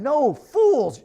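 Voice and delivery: loud, high, angry rebuke; angry retort. A narrator's reading. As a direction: snap at the fools, stamping. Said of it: "stamping his foot".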